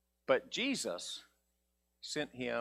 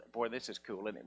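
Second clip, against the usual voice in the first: usual speaking voice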